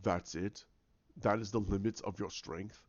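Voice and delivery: low, soft mock taunt; taunting villain voice. Villain belittling Rey's Force strength, low taunt.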